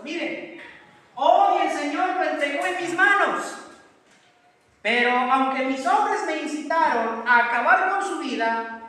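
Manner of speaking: impassioned cry up to the king; David shouting. Retold or imitated. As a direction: call out to the king across the distance, pleading. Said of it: "David le grita y le dice".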